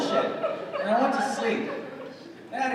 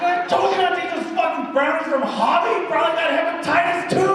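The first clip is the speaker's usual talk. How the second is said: high, loud nagging wife; scolding wife; 13 semitones higher, 7 dB louder than usual. Retold or imitated. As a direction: scold him like an angry wife.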